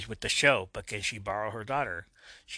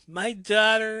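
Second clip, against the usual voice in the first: much higher, louder anguished scream; 11 semitones higher, 9 dB louder, slower than usual. A narrator's reading. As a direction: wail for the lost child. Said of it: "Liberty screams".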